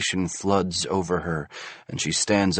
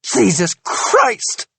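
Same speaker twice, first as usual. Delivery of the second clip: high, loud, slow enraged cry; bristling rage; 12 semitones higher, 5 dB louder, much slower than usual. A narrator's reading. Wife bristling with rage at husband, loud. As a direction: let the rage boil over. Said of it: "bristling with rage".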